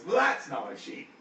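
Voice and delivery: confused, loud orc voice; puzzled orc, squinting at the sheep. Acted. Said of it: "looks at you really confused and says".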